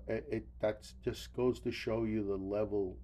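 usual speaking voice

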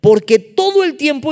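loud, high burst of angry declaiming; indignant, high-flown rebuke. Acted. Preacher voicing Saúl raging at his son, loud high declaiming. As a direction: thunder the line as an enraged king. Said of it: "Estaba bien altisonante".

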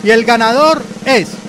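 loud suspenseful winner reveal; dramatic reveal. Acted. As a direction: build suspense before the name. Drum roll reveal.

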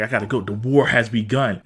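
low, grave retold declaration; imitated solemn announcement; mock grave hero. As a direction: drop low for a hero's vow. Quoting hero's solemn declaration.

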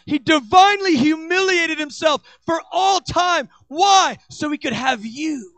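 loud, high, impassioned sermon; full-force preaching. Acted. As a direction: preach it hard to the congregation.

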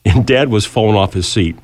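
ordinary talk

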